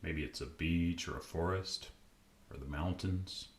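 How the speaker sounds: calm, unhurried meditation voice with long pauses; guided meditation. Acted. Podcast host guiding listeners to relax, calm meditation voice. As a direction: ease the listener gently into stillness.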